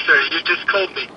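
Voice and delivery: normal talking voice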